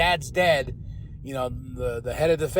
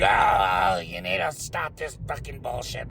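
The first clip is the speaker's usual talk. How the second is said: nasty dad. Retold or imitated. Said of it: "he's being an asshole to his son".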